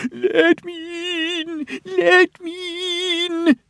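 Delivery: very high, slow, wailing ghost; spooky voice. Pretend. Percy playing ghost outside the shut shed doors. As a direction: moan it like a ghost at the door. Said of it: "said Percy in a spooky voice".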